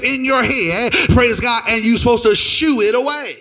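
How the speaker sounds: loud, intense sung-edged exhortation; full-force preaching. Real, not acted. Preacher urging church out of anger, full-force preaching. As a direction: drive each command home at full voice.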